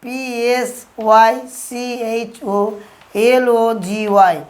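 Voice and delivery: slow letter-by-letter spelling. Shown as a demonstration. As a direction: spell it out slowly, pausing between letters. Teacher spells out a word.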